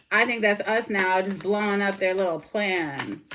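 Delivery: fired-up rant at the cards; heated rant. Real, not acted. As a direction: tear into the toxic ex. Continued rant about blowing plans up.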